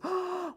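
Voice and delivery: high, sharp mock gasp; high theatrical gasp. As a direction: gasp in fake shock. Mock shock at age.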